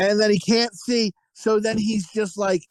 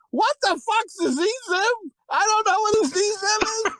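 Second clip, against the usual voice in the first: much higher, incredulous squawk; baffled outrage; 10 semitones higher than usual. Retold or imitated. Telling friends about a job form, baffled high squawk. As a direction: act totally baffled by the word. Baffled reaction to pronoun question.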